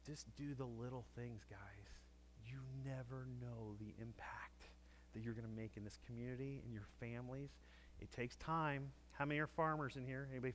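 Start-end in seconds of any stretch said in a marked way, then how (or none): 2.4-4.7 slow, whispered aside; soft whisper. Real, not acted. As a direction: drop to a whisper to make it land. Hushed emphasis in sermon.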